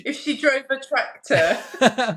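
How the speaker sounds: laughing through the words; laughing joke. Real, not acted. Guest jokes about her own accent, laughing. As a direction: laugh while cracking the tractor joke.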